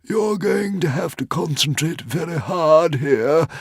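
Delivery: high, slow fretful dragon; a worried little dragon pleading; fretting. A narrator's reading. Dragon warning Hiccup, high and fretful. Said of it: "fretted the Woden's Fang".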